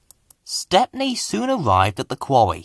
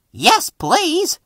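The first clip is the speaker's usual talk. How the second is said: eager driver. Acted. Driver voiced in story.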